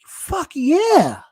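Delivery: loud, high fan cheer; excited cheer. Retold or imitated. Fan reacting to the new coach, excited cheer. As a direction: cheer like the coach just won you over. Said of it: "And I'm like".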